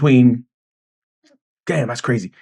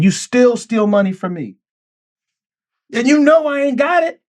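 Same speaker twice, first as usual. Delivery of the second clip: exasperated outburst; 10 semitones higher than usual. Real, not acted. Venting about stolen money.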